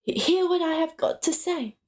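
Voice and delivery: a nervous hobbit covering a slip; flustered. Acted. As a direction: trip over the first word in a fluster. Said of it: "he was so flustered".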